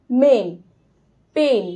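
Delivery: মেন and পেন with a crisp short e; teacher modelling the e sound; short e demo. Shown as a demonstration. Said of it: "এটা উচ্চারণ হয় এর মতো".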